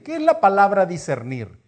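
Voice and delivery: normal talking voice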